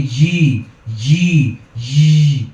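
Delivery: loud "জি" with a running zh-like buzz; buzzing wrong jim. Shown as a demonstration. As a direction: let the consonant of "জি" leak and buzz. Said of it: "আওয়াজ জারি করে বলি".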